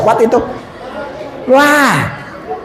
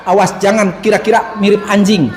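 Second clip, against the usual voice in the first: usual speaking voice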